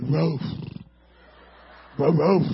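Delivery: lightly voiced toothless bark; gummy mock woof; toothless dog bark. Comedian imitating the neighbor's toothless dog, mild mock bark. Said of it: "It used to bark like this".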